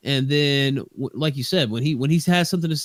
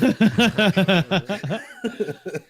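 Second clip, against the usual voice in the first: real drawn-out laugh of discomfort; uncomfortable laugh; 7 semitones higher than usual. Real, not acted. Asked for an opinion on the show, laughing uncomfortably. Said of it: "Uncomfortable laugh".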